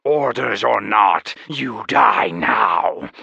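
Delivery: slow, loud, final pronouncement; deadly creature verdict; dragon declaration. Acted. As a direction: sentence him like a monster. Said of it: "the dragon declared".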